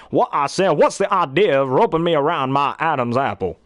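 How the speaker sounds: big stuttering rooster voice; Foghorn Leghorn. Acted. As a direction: bluster like a Southern rooster. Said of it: "Foghorn Leghorn, which is my grandpa's favorite too".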